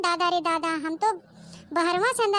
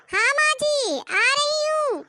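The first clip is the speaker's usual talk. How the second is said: high answer; 6 semitones higher, slower than usual. Acted. Bride answers the mother-in-law.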